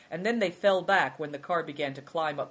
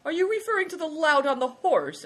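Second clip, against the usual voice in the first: high, loud jovial question; loud, lifted, amiable enquiry; good-humoured; 13 semitones higher, 8 dB louder than usual. A narrator's reading. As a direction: tease him good-humouredly. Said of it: "asked Good Fortune good-humouredly".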